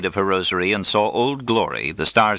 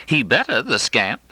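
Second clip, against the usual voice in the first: playful cry; 7 semitones higher than usual. A narrator's reading. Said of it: "cries Mother".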